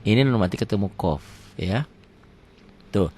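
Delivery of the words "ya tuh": ordinary talk, nothing marked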